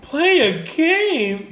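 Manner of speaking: drawn-out, high, disbelieving question; incredulous echo. Real, not acted. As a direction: throw it back very slowly, in disbelief. Throws guest's euphemism back at him.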